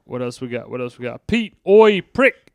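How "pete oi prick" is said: higher, louder mock yell of an insult; mock shout. Shouting a film insult at Pete, higher and louder. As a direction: bark it out like a rude mate.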